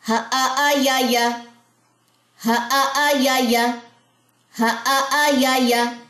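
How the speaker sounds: slow, sing-song syllables; rhythmic reading. Shown as a demonstration. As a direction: read it in one steady rhythm. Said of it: "practice कर लेते हैं".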